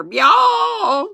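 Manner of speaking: very high, loud bawl; calf's bellow. A narrator's reading. Second calf answering as everything goes wrong, high bellow. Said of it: "I've never heard a cow make these noises".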